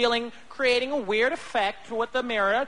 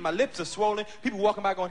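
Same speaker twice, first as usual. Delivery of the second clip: ordinary delivery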